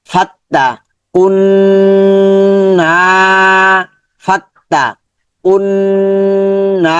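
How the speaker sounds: slow recitation demo, না stretched; elongated না. Shown as a demonstration. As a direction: hold the না in কুন্না long and steady. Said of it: "নাটা লম্বা হবে".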